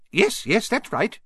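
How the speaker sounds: very high squeaky little professor. Acted. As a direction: squeak it out brightly. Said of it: "he squeaked".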